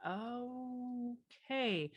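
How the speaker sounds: long drawn-out soft okay; slowed cadence. Retold or imitated. Consultant adjusting to slow Midwest bank clients, slowed cadence. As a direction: stretch the word right out, softly. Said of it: "their cadence".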